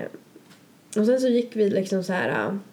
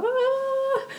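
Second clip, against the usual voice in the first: a high, shrill sing-along shriek; mock scream. Reenacting screaming along with friends, high-pitched. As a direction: let out a wild groupie squeal. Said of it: "alltså verkligen så skriker".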